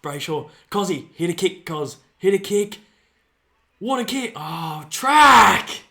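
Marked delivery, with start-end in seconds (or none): 0.7-4.3 excited urging. Real, not acted. Urging player while watching. 4.9-5.8 a big high shout of the name; excited yell. Real, not acted. Fan screaming at the screen for a player, loud yell.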